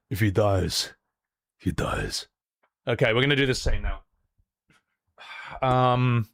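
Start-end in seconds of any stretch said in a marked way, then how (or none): 0.1-2.3 slow, very low put-on voice; deadpan film-villain quote. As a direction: drop low and slow, without feeling. Quoted line in low acted voice.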